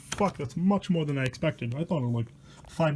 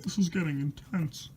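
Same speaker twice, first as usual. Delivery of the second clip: normal talking voice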